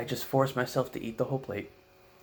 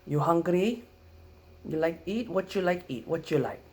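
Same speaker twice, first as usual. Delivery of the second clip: high, louder broken-English questions; grandma's Filipino accent; 7 semitones higher, 5 dB louder than usual. Retold or imitated. Grandma offering breakfast to the kids, Filipino accent. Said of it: "first thing ... we wake up".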